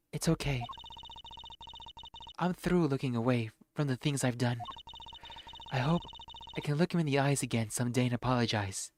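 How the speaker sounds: usual speaking voice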